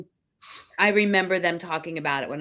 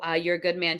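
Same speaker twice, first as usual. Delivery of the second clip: ordinary delivery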